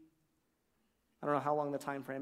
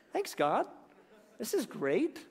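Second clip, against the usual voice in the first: high, chirpy thanks; mock happy Jonah; 7 semitones higher, slower than usual. Jonah thanking God for the shade, high and chirpy. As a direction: play Jonah thrilled with his plant. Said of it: "Jonah was extremely happy".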